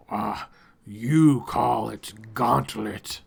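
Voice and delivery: slow, gloating creature voice; lightly voiced creature leader, gloating; masked creature leader. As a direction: savour the offer of a deadly game.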